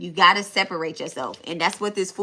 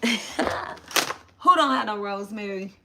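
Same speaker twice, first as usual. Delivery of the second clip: "Who don't have no rosemary?" drawn out slowly through a laugh; 3 semitones higher, slower than usual. Real, not acted. Reader cracking up at her own missing rosemary, laughing it out slowly. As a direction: laugh at yourself as you say it again, slower.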